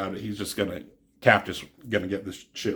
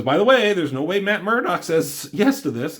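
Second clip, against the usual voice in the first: raised warning; 7 semitones higher, 5 dB louder than usual. Retold or imitated. Cap warning about Daredevil refusing.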